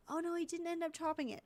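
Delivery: high, quick dismay; surprised. Real, not acted. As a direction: realize the mistake out loud. Discovering an editing mistake.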